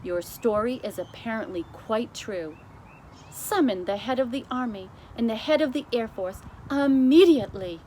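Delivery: queen ordering the army and air force; commanding queen. Acted. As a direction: snap into orders.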